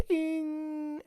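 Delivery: high sharp electronic bing; mock feedback bing. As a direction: squeal it like speaker feedback. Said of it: "it will go".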